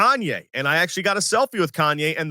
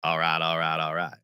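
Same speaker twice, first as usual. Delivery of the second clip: Matthew McConaughey impression; 12 semitones lower, 5 dB quieter than usual. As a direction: drop way down and stretch it like McConaughey. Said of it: "That's my McConaughey".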